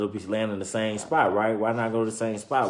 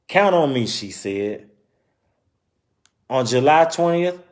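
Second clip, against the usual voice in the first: story narration; 2 semitones higher, 5 dB louder than usual. Acted. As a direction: clear read-aloud storytelling voice. Reading picture book aloud.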